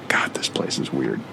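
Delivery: low muttered grumble under his breath; muttered aside. Acted. Traveler muttering to himself on the train, quiet grumble. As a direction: mutter it to yourself so nobody hears.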